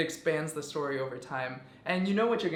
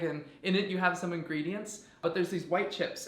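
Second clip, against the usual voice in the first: normal talking voice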